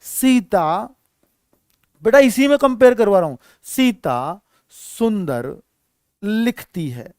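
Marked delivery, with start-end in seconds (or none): 3.6-7.1 word by word, very slowly; slow dictation. Shown as a demonstration. Teacher writing an example for students, slow dictation. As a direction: dictate it with gaps between words.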